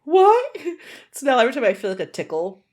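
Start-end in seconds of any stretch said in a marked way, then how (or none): 0.0-0.6 rising, louder double "What?"; baffled as if a fly came out of your nose; high rising disbelieving "What?"; shocked disbelief. Retold or imitated. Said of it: "and I was like".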